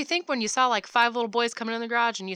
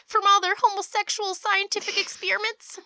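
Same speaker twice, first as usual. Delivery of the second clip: high loud nosy neighbor; 10 semitones higher, 5 dB louder than usual. Pretend. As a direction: call out like a busybody neighbor. Playing nosy neighbor calling out.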